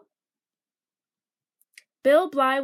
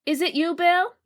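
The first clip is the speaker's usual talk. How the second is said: mild storybook yell, pitched up; calling out; 4 semitones higher, 3 dB louder than usual. A narrator's reading. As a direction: call to Bill in the back room, lightly raised. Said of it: "She began yelling".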